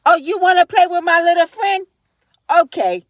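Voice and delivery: Scarface impression. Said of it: "dressed like Scarface".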